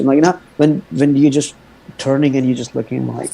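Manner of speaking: ordinary talk, nothing marked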